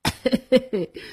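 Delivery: forced clearing of the throat; dry fake cough. A narrator's reading. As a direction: cough to disguise the voice. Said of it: "外面的人干咳了几声".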